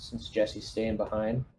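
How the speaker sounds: usual speaking voice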